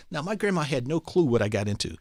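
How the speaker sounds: ordinary delivery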